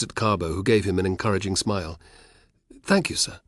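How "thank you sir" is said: timid thanks. Acted. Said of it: "he ventured".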